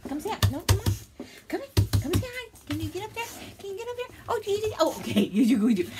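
high, loud sing-song pet talk. Real, not acted. Coaxing the dog.

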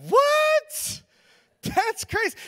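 very high, squeaky mock disbelief; hammed-up falsetto denial; feigned shock. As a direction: shriek it in badly faked innocence. Feigning innocence when accused.

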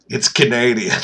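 ordinary talk, nothing marked

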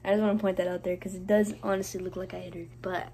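low, soft, earnest; quiet serious aside. Real, not acted. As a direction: calmly clear up a misunderstanding. Clarifying, quiet confessional aside.

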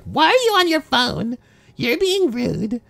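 high squeaky mock scolding; mocking nagging voice. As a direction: pitch it way up like nagging friends. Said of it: "everybody's like".

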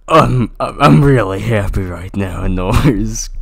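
loud, gushing happy rambling; loud lovestruck gushing; loud excited character. Acted. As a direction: blurt it out all excited. Character voiced in story.

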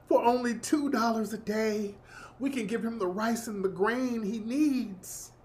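soft, slow, solemn charity-ad narration; a sorrowful telethon plea, hushed and slow; charity ad voiceover. Retold or imitated. Sally Struthers narrating a charity ad, softly and slowly. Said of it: "Sally Struthers doing the voiceover".

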